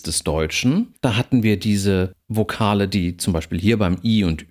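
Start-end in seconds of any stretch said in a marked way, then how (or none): none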